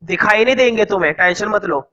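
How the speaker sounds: usual speaking voice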